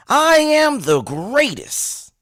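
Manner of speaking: high, drawn-out boxer's boast; Muhammad Ali. Acted. Host modelling a shout for listeners, boastful champion voice. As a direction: proclaim it slowly, stretching the opening word high. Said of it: "in your best Muhammad Ali voice".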